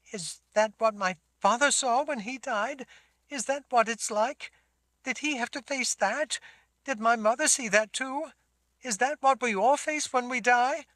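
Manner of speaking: put-on high, pleading girl's questions; a scared child begging to know; frightened girl. Sammy asks frightened questions.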